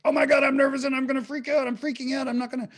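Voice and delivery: high, fast freak-out; mock panic. Teacher mimics someone freaking out on stage, panicking. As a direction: play a rushed, high-pitched nervous meltdown. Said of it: "It's not like ... I'm freaking out".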